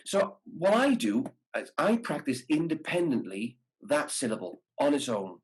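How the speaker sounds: usual speaking voice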